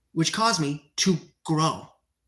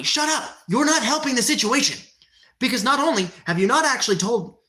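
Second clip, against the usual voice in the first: angry snap; 4 semitones higher than usual. Real, not acted. Streamer snapping at a commenter, angry. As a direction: cut the troll off hard.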